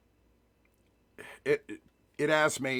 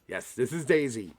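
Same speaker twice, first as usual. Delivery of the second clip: fond introduction; 5 dB louder than usual. Real, not acted. Showing his dog to viewers.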